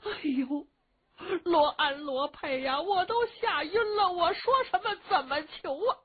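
put-on high, tearful old lady in a panic; frightened wail. A narrator's reading. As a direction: cry helplessly to the servants.

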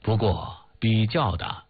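usual speaking voice

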